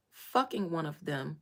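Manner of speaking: usual speaking voice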